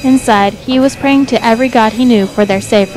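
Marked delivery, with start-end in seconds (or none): none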